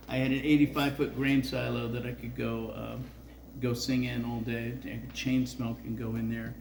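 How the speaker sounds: ordinary talk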